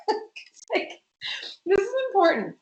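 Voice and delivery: high-pitched, laughing insistence; amused, squeaky-high emphasis. Real, not acted. Laughs while introducing food boundaries.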